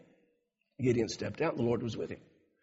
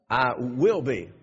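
"will" drawn out slowly and leaned on; slow "I WILL be", weight on "will"; stress on will; 6 dB louder, slower than usual. Shown as a demonstration. Preacher stressing "will" to the congregation. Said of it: "what did he say?".

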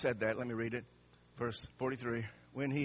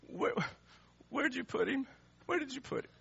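high, choked question with a false start; weeping; 10 semitones higher than usual. Acted. Jesus asks mourners about his dead friend, weeping. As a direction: play him crying, struggling to get the words out. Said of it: "he's weeping".

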